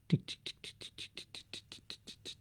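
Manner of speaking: whispered tick tick of a clock; ticking clock sound. Retold or imitated. A mock timer while waiting for a thought, whispered ticks. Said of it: "is my phone ready".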